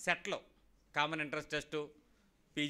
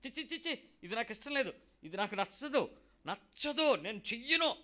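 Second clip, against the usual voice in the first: whiny refusal; 5 semitones higher, 3 dB louder than usual. Retold or imitated. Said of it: "అనుకుంటున్నప్పుడే".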